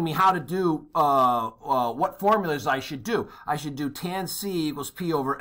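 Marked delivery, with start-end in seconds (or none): none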